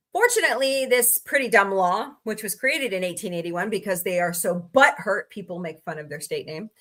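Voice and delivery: usual speaking voice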